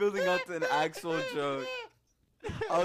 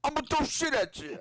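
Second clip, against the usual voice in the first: pitched-up hammy celebrity mimicry; Christopher Walken; 9 semitones higher than usual. Doing the actor as monkey, high hammy impersonation. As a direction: blurt it high in a put-on voice. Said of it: "I'm still doing Christopher Walken".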